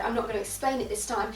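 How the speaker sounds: usual speaking voice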